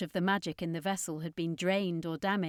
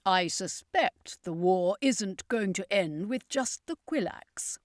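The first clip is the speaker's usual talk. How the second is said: slow, grim showman; circus master; slower than usual. A narrator's reading. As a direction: suspect the worst, slowly. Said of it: "the circus master said".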